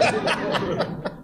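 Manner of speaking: breathy laughing burst; a short airy laugh; real laugh. Real, not acted. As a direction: laugh between the words. Laugh after admitting fear.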